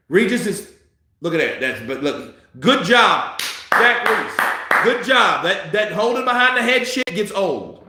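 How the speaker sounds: normal talking voice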